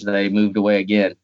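usual speaking voice

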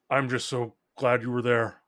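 relieved dad. Acted. Dad to his returned child, relieved and gushing. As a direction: pour out relief at seeing them.

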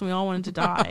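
ordinary delivery

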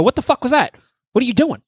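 high, alarmed outburst; jumping at a touch in bed, startled yell. Retold or imitated. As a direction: blurt it out as if jolted awake. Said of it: "I go".

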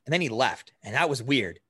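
usual speaking voice

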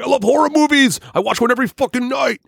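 very high silly voice; mocking Sam. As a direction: squeak it like a nerdy fan. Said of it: "I was like".

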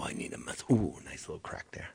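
pained whisper. Real, not acted. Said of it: "Oh, my back".

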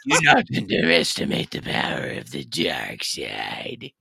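low, breathy Sith emperor voice; menacing Star Wars emperor. Retold or imitated. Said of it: "That wasn't bad for the emperor".

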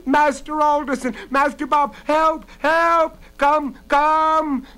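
very high, loud cries for help; frantic yelling, word by word; desperate shout. A narrator's reading. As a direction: scream for the master to come. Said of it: "Bella shouted".

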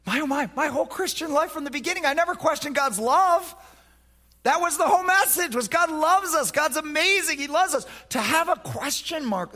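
high, loud preaching about God's love; full-force preaching. Acted. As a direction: preach it out with fervour.

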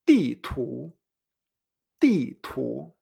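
"di tu" with di falling and tu rising; falling then rising. Shown as a demonstration. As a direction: model di tu, drop then rise. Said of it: "que baja y sube".

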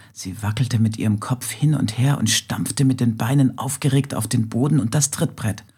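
ordinary delivery, nothing marked

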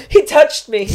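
put-on high, loud child's squeal of protest; outraged little kid. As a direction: squeal it like a dramatic little girl telling on someone. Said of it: "like this, like, proper, like, little characters".

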